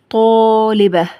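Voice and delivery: loud, stretched example word; drawn-out dictation. Shown as a demonstration. Teacher giving pupils a word to work on, drawn out. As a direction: hold each syllable for the class.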